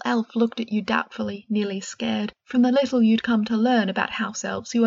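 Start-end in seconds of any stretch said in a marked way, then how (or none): none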